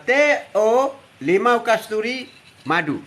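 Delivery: each word drawn out slowly; slow drink name. Shown as a demonstration. Grandpa on live stream naming his drink, slowly and deliberately. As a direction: spell out the drink slowly for the viewers. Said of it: "Hari ni minum".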